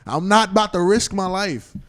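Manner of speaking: high, loud, incredulous retort; emphatic protest. Retold or imitated. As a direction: stretch it out loudly like life is at stake. Acted protest, fear of being shot.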